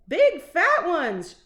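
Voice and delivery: put-on loud, slow boyish yell; little boy shout. A narrator's reading. Little boy shouting about the mice. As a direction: bellow it out in alarm. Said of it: "shouted a little boy".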